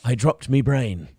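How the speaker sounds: lightly voiced pirate, slow and low; a hint of a drunken pirate; Jack Sparrow. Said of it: "more of a Jack Sparrow one".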